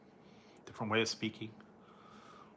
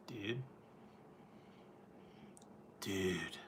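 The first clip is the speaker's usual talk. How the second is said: breathy whispered "Dude"; hushed surfer "Dude"; 3 semitones lower, 15 dB quieter than usual. Shown as a demonstration. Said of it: "say, 'Dude.'".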